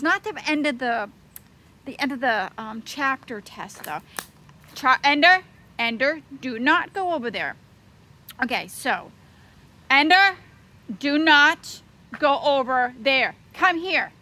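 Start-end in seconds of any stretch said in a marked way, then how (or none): 4.8-7.6 loud call to the dog. Real, not acted. Calling dog away. 9.9-14.1 loud, slow stern command; firm call. Real, not acted. Calling dog again.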